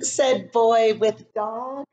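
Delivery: sing-song, drawn-out storybook narration; lilting story reading. Acted. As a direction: let the words float like snow. Reads picture book to class.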